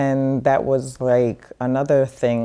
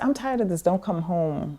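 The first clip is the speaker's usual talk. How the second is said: high stern fed-up mother; mother fed up with her child, high stern voice; mom fed up; 5 semitones higher, 4 dB quieter than usual. Retold or imitated. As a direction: lay down the law like a tired mom, pitch raised. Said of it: "she said".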